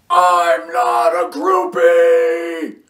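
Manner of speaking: high, loud, slow shriek; outraged scream. A narrator's reading. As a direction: shriek it, stung by the insult. Cheryl insulted, screams.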